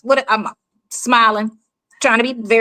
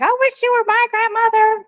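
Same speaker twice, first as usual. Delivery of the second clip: put-on sweet young girl; 13 semitones higher than usual. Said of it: "All her friends love me and be like".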